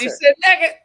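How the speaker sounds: high, loud scandalized squeal; gleeful tattling. Real, not acted. Gleefully calls out a naughty word.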